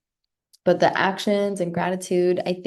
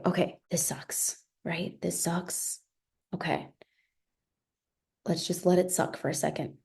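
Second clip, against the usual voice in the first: hushed, resigned inner voice; quiet self-talk; 8 dB quieter than usual. Pretend. Coach voicing her inner self, hushed and resigned. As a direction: admit it softly to yourself.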